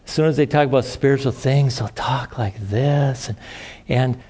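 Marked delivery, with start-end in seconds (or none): 1.8-3.3 slow, softer, affected pious voice; put-on holy voice. Shown as a demonstration. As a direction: imitate a preachy put-on voice. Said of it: "some people talk in a different voice".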